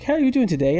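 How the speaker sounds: high mock British greeting; British accent. Teacher greeting him in a mock British accent. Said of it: "in a British accent".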